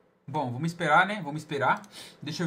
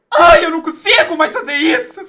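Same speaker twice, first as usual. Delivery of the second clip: loud, high mock wail with a laugh; hammed-up wail; 10 semitones higher, 15 dB louder than usual. Streamer to chat after losing, mock wail. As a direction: whine loudly like a spoiled kid losing. Fake crying over lost game.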